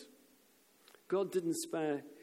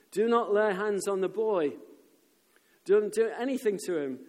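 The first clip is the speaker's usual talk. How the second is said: raised, commanding call; loud, firm order from above; 4 semitones higher, 6 dB louder than usual. Acted. Angel orders Abraham to spare the boy, calling out. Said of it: "called out to him from heaven".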